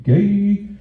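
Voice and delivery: high loud mock announcement; voiced earlobe. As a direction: blurt it like a gossip. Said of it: "your lobe would be like".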